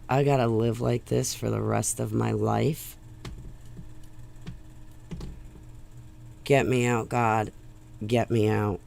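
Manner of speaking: low, soft hopeless murmur; quiet and low, praying for escape; despairing inner thought. Retold or imitated. Waking next to the ex, low quiet despair. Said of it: "and think".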